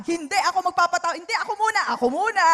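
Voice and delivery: high, loud, quick insistence; mock eager rivalry. Congregant racing to do good first, playfully insistent. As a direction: jump in to claim first place.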